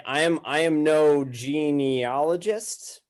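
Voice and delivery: ordinary delivery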